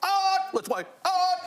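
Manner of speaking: mock the fans parroting a quiz catchphrase; imitated catchphrase. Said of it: "like, when people say".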